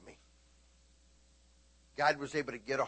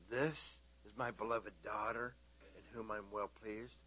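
soft, gentle voice of a loving father; tender hush; 4 semitones lower, 11 dB quieter than usual. Acted. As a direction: hush it like a blessing.

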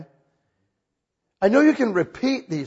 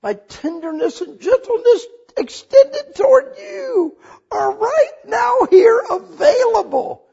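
emotional preaching; 7 dB louder than usual. Acted. Preacher voicing God's loving offer.

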